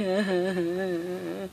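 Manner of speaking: soft, low pretend sobs; play-cry tailing off quietly; mock sobbing. Girl crying over her dropped purse, soft mock sobs. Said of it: "khóc hoài à".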